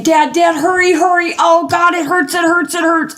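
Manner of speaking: high, urgent cry of pain, replaying the panic; imitating a panicked yell for dad to hurry; retold pained screaming. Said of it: "I was screaming like that".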